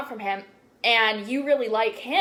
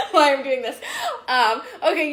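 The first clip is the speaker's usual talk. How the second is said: louder, higher, laughing through the words; laughing at herself; 5 semitones higher, 5 dB louder than usual. Real, not acted. Laughing at herself while talking to viewers, real giggle. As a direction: giggle at your own tangent. Laughs at her own tangent.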